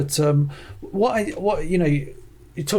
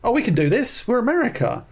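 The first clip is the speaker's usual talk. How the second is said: cocky, higher mock boast; mock arrogance; 8 semitones higher than usual. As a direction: play the brash overconfident government. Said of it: "where it's like".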